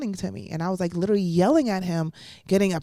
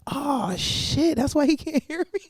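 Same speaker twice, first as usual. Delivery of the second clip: realizing; 7 semitones higher than usual. Retold or imitated. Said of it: "And I was like".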